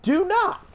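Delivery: warning Karens off, forceful; stern warning. Real, not acted. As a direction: do not hit high and hard. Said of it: "And I repeat".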